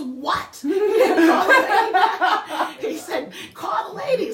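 very high, loud mock yell breaking into laughter; incredulous yelling. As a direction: imitate an exasperated husband shrieking the order. Imitating her incredulous, urgent husband.

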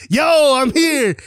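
loud high triumphant shout; heroic entrance. Retold or imitated. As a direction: announce your arrival boldly. Hal bursts in to the rescue.

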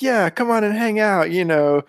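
high, bright salesy youth pitch; mock youth counselor. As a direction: oversell the fun like a cheesy youth leader. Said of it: "it was all like".

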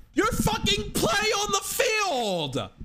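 high, slow furious yell; angry shout. Real, not acted. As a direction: bellow every word out. Ranting about coaches' priorities.

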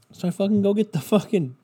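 normal talking voice

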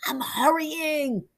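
high, drawn-out whine; protesting. A narrator's reading. Peter calling back to his friend, protesting. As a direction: stretch it out, straining to keep up.

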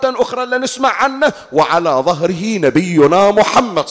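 loud, soaring preacher's climax; fiery sermon peak; preaching at full force. Acted. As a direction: lift the hall with it, slow and loud. Preaching climax about the Day of Judgment.

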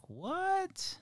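high squeaky stunned what; a sudden stunned squeak of disbelief. Retold or imitated. Parents reacting to a kid's remark with high surprised what. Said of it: "we're like".

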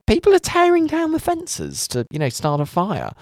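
very high mock disbelief; incredulous quote. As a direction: squeal the question in disbelief. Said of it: "I was like".

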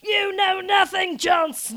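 high, loud drawn-out quoted yell; imitated yell. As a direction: yell it theatrically, as if across the house. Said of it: "to yell across the house".

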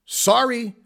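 normal talking voice

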